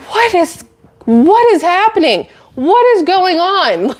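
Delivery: high, slow, incredulous what is going on; squeaky stunned disbelief at the buyer; exasperated disbelief. Real, not acted. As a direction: ask the heavens in disbelief. Disbelief at the meme coin buyer.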